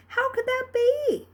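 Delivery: plain reading voice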